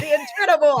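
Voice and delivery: high emcee billing; stage announcer. Pretend. Host trying out a stage title for Ron, announcer voice. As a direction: hype the act's title. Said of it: "get up on the stage and announce you".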